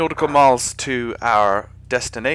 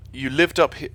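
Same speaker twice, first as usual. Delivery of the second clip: ordinary talk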